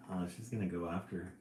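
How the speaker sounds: ordinary delivery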